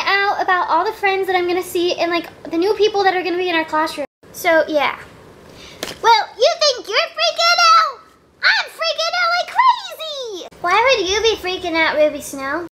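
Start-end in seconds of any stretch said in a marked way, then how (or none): 6.0-10.5 much higher, laughing excited doll voice; high-pitched giggly panic; playful little-girl freak-out. Acted. Doll voicing panic before school.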